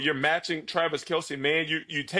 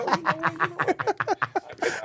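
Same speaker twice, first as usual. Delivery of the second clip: imitating a convinced guy; 12 semitones higher, 4 dB louder than usual. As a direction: play a lovesick guy talking himself into it. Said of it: "Like I was with this read receipts".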